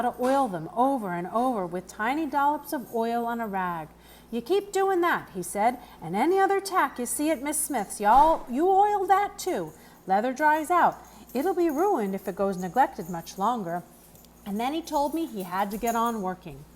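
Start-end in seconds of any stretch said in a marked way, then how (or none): 4.3-13.8 lightly voiced country groom, a little higher; a hint of a kindly old stableman; the groom explaining the pony's hooves, lightly voiced; Mr Grimes. A narrator's reading.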